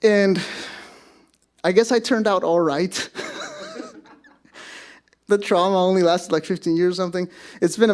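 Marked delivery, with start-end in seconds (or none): 3.1-3.9 high laugh. Real, not acted. Laughs at own story.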